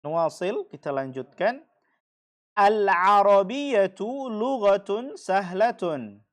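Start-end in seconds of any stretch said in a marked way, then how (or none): 2.5-6.2 slow, loud and deliberate; model sentence. Shown as a demonstration. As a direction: spell it out slowly for the class to repeat. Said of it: "teacher models sentence for class". (pelan, keras, jelas seperti guru)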